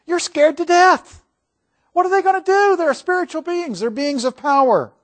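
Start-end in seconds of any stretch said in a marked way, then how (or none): 0.1-3.8 acting scared. Describing terror at seeing angel.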